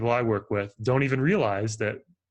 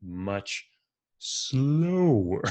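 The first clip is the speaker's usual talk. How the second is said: slow; 2 semitones lower, much slower than usual. Shown as a demonstration. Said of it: "say it much, much slower".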